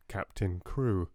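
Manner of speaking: ordinary delivery, nothing marked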